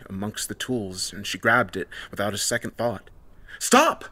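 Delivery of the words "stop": high, loud cry to halt; sudden high yell; sharp command. A narrator's reading. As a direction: shout to stop someone in their tracks. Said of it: "Heather said as the redhead tried to climb".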